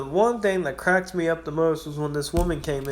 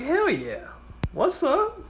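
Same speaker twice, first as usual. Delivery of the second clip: much higher, bursting with glee; excited cheer; much slower than usual. Retold or imitated. Gas station clerk cheering when the lights stop buzzing, high excited whoop. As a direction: whoop like you just won.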